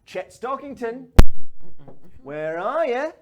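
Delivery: high, sing-out hunting call; high-pitched villain on the prowl; taunting call. Acted. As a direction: call out for someone hiding in the room. Fairy calling out for Chet.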